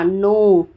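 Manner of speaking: नो said as "no" with the K silent. Shown as a demonstration. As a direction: say नो like know, no K sound. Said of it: "यहाँ पे के silent होता है".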